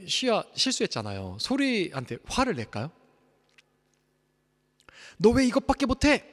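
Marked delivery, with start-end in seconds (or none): 5.2-6.2 put-on sharp, higher, louder rebuke of a child; mock angry scolding. Father pretending to scold his toddler daughter, mock angry. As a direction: snap at her as the angry father he isn't.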